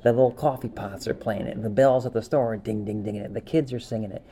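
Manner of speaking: normal talking voice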